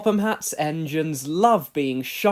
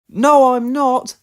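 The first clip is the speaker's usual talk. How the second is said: high, loud, slow protest; indignant denial; 8 semitones higher, 12 dB louder, slower than usual. A narrator's reading. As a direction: puff back at the teasing. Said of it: "wheeshed Percy".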